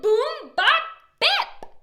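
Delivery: ordinary delivery, nothing marked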